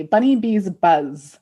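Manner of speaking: normal talking voice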